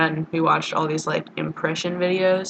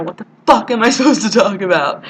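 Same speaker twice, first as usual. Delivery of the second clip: higher, louder, breaking into laughter; laughing mock outburst; 4 semitones higher, 7 dB louder, faster than usual. Retelling a question to a friend about the video, laughing. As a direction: blurt it out through a laugh.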